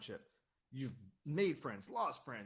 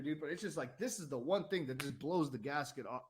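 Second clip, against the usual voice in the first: ordinary talk, nothing marked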